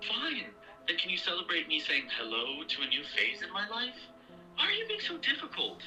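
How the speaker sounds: high, frustrated pleading; exasperated. Acted. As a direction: voice climbing in frustration. Daughter quarrels with mother.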